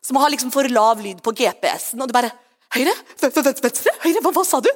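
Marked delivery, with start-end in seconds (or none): 2.8-4.7 stammering, hurried confused questions; flustered, mock panic. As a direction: fumble anxiously for directions, hammed-up. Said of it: "bli så redd for å gå glipp av Guds stemme".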